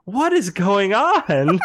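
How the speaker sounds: slow, loud, laughing disbelief; baffled crew. Retold or imitated. Film crew watching the explosion, slow laughing disbelief. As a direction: stretch it out, stunned and amused.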